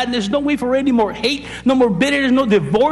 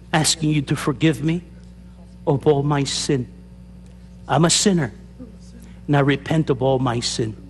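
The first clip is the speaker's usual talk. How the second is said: led prayer; 4 semitones lower than usual. Acted. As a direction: pray it line by line so they can follow. Leads the sinner's prayer.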